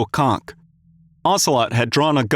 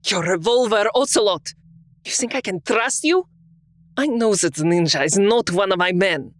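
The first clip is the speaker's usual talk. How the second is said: higher, sharp accusing Russian voice; angry Russian woman; 6 semitones higher than usual. A narrator's reading. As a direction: fling the accusation at him. Olga angrily accuses Ocelot.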